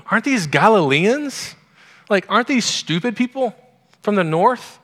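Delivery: high, sneering mock scold; scornful mockery. Mockers scolding the Galileans, in a high scornful sneer. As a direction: sneer at them as northern fools. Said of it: "they're being scolded".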